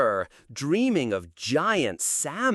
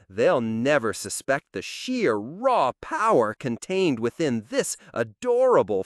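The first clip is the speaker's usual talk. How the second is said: usual speaking voice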